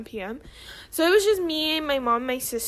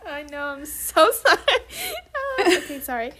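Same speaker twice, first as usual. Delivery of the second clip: laughing; 4 semitones higher, 3 dB louder than usual. Real, not acted. Still laughing after the mishap.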